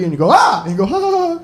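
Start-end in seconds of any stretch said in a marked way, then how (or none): 0.3-0.6 short, loud, high yelp; startled scream. Retold or imitated. Friend jumps out from a corner, startled high yelp. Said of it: "they jump out and scare you". 0.8-1.4 high-pitched imitated laughter; relieved laugh. Scared person after friend's prank, high relieved laugh. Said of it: "'cause you're so relieved".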